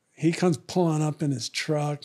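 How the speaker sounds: normal talking voice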